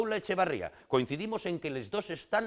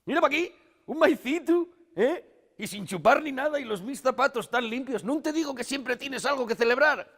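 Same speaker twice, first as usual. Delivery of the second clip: high, loud, giddy delight; raised, eager look-at-this excitement; excited showing-off; 11 semitones higher, 6 dB louder than usual. Acted. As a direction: squeal it out, thrilled with the trick. Delighted demo after uncorking bottle.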